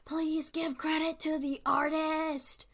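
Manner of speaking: ordinary delivery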